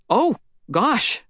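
a sudden high "Oh, gosh"; startled gasp. Acted. Startled boy to a stranger, a high gasp. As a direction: caught off guard, blurting it out.

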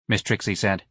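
ordinary delivery, nothing marked

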